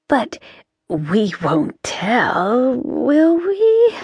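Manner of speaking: slow sly hush on the secret; a hint of a gossiping witch sharing a secret; sly confiding witch. Missus Cringe confiding.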